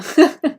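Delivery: quick high burst of laughter; a real laugh, amused at her own Welsh sounds; short laugh. Real, not acted. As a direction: laugh at yourself.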